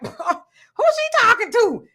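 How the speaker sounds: high, slow, laughing disbelief; incredulous laughing aside. Retold or imitated. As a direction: scoff through a laugh at her nerve. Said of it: "I was like".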